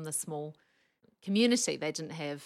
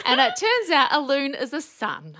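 louder and higher, laughing through the punchline; 9 semitones higher, 12 dB louder than usual. Real, not acted. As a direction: laugh out the reveal.

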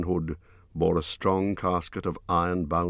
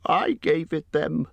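much higher, slow, small frightened voice; frightened squeak; 11 semitones higher than usual. A narrator's reading. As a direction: squeak it out in terror. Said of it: "squeaked Bilbo".

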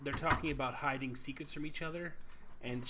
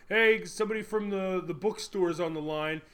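loud, raised call through the house; dad calling out; 5 semitones higher, 5 dB louder than usual. Retold or imitated. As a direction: imitate a dad hollering to his kid. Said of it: "you hear the dude's dad go".

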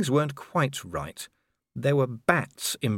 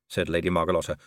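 plain reading voice

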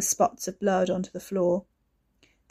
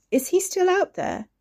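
high, nervous question; fearful check; anxious; 11 semitones higher, 3 dB louder than usual. Acted. Frightened question in bed.

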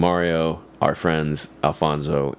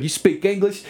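mock Southern cop; 13 semitones higher than usual. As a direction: ask it like a smug Southern cop. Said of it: "really thick Southern accent".

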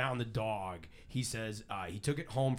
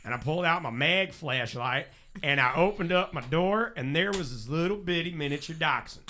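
lively Southern country storyteller; Southern accent; 3 semitones higher than usual. Retold or imitated. Host quoting the motorist who found the dog, Southern accent. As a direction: read it as a Southern motorist, punching the key words. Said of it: "reading it in that accent".

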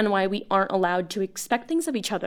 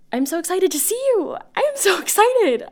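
much higher, louder gush of excitement; so excited; 9 semitones higher, 3 dB louder than usual. Acted. Caller gushing to her old friend about meeting, much higher and louder. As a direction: let the excitement burst out.

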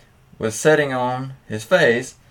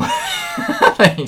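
high squeal of laughter through the words; laughing; 3 dB louder than usual. Real, not acted. Host cracking up at a listener's story, laughing high. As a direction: laugh it out in disbelief.

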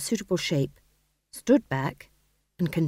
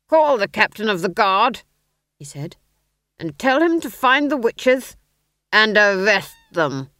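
duke's order; 3 semitones higher, 7 dB louder than usual. Acted.

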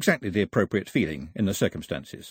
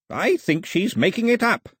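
snapped; 12 semitones higher, 4 dB louder than usual. Acted. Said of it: "Ataba snapped".

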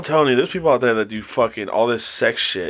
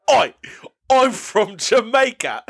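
higher, hammed-up fake Jamaican accent; mock Jamaican accent; 8 semitones higher than usual. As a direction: play a cheesy Jamaican, half laughing. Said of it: "that's how we talk".

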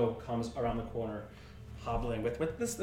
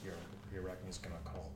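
deep whisper; 8 semitones lower, 14 dB quieter, faster than usual. Acted. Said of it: "His very deep voice. he's whispering".

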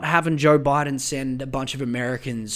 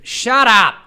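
sudden loud, high shout at a honking driver; irritated yell; 7 semitones higher, 6 dB louder than usual. Real, not acted. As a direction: yell it at whoever is beeping outside. Yelling at someone beeping a horn.

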